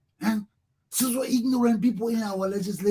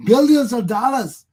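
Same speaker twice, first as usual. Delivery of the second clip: emphatic outcry; 2 semitones higher, 7 dB louder than usual. Real, not acted. Angry rant about stolen money.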